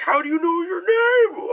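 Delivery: high, hammed-up cry of disbelief; mock astonished shout. Crew hears stranger say a name, exaggerated shock. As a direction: imitate a crew's stunned outburst, drawing out the end.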